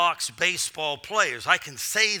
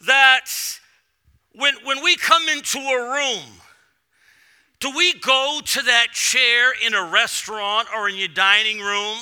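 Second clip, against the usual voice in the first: usual speaking voice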